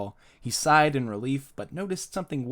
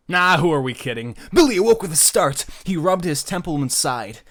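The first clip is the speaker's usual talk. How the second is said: loud, excited, raised narration; mock-dramatic reversal; 5 semitones higher, 8 dB louder than usual. A narrator's reading. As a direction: burst out with the twist. Narrator gleefully overturns tragic ending.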